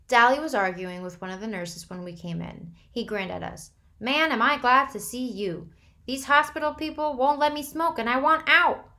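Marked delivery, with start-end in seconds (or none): 4.0-8.9 loud, high brash voice; brash and restless; loud ornery. A narrator's reading.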